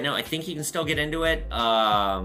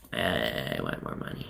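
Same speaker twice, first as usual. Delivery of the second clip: much lower, softer, slow deadpan aside; deadpan joke; 14 semitones lower, 7 dB quieter, slower than usual. Pretend. Shop owner listing price reasons, lowered deadpan punchline. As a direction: drop the voice low and dry for the punchline.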